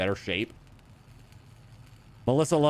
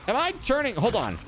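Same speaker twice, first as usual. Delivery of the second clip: high, alarmed disbelief; shocked; 10 semitones higher than usual. Real, not acted. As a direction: react with sudden alarm. Shocked at game infection.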